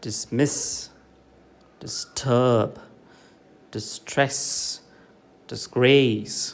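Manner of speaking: dismiss, disturb, distress, disgrace, each stressed after dis; light dis, then punch MISS, TURB, TRESS, GRACE; word list with the second syllable of dismiss, disturb, distress, disgrace stressed. Shown as a demonstration. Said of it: "falls on the second syllable".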